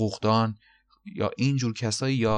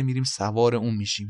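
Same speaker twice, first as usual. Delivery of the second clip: usual speaking voice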